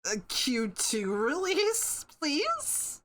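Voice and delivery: whiny pleading, squeaked high at the end; begging. Pretend. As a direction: beg like a kid, please way up high. Begging Toby Fox for sooner release.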